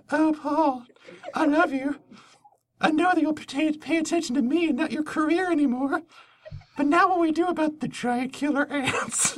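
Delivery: high breathy swooning woman; man doing a woman's voice; lady voice. Pretend. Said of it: "This is my lady voice".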